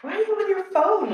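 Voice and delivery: husband's surprised question. Retold or imitated. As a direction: play the husband catching her on the phone. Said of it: "and he's like".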